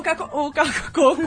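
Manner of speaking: stumbling words while choking; choking on a drink. Real, not acted. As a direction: choke and laugh mid-word. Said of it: "Ela engasgou". (engasgando com a bebida)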